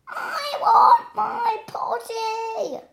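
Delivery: put-on very high, slow, loud child shout; a little girl screams for her potty; princess shouting. A narrator's reading. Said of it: "she cried".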